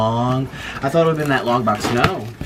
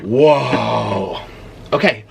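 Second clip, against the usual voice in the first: ordinary talk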